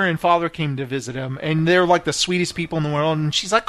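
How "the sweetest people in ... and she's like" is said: normal talking voice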